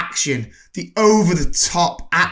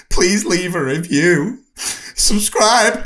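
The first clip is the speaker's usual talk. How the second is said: higher, louder plea ending in a squealing laugh; hammed-up plea; 5 semitones higher, 4 dB louder, much slower than usual. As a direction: beg them to subscribe, then shriek with laughter. Over-the-top sign-off plea.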